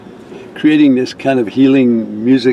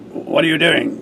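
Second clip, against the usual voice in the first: a mild mock stern challenge; the suspicious staff member, lightly voiced; 4 semitones higher, 3 dB quieter than usual. Activities woman catching the musicians, mildly stern. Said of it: "with a stern look in her eyes".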